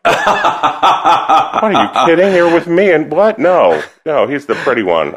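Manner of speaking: high, slow laughing protest; mock outrage through laughter; hammed-up laughing retort; laughing disbelief. Retelling her own reply laughing.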